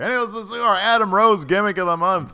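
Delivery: high, loud show announcement; segment announcer. Pretend. Host introducing the Adam Rose segment, loud announcer voice. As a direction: belt out the segment title.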